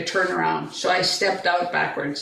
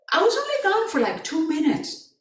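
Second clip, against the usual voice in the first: high-pitched retold excuse; mother protesting, high at the start; defensive protest; 7 semitones higher than usual. Mother protests to her son.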